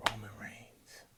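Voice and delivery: hushed dramatic whisper; whispered reveal of the betrayal. Real, not acted. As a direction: drop to a whisper for the shock. Low blow dramatized.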